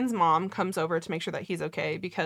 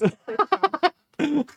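slow, louder, hammed-up announcer call; mock announcer; 8 semitones higher, 7 dB louder, slower than usual. Teasing listeners like a ringside announcer. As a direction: announce the fight like a ringside showman.

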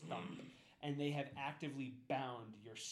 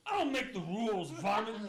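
cowboy guard; 7 semitones higher, 10 dB louder than usual. Acted. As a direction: holler it like a backwoods sheriff. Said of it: "Listen, it's like".

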